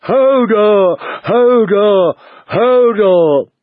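high, long-held shouts; Hodor shouting. A narrator's reading. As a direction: keep bellowing it in alarm. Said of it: "Hodor was still shouting".

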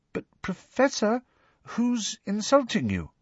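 high, timid student voice; tentative, careful question. A narrator's reading. Parvati questioning her upset professor, timid. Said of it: "asked Parvati timidly".

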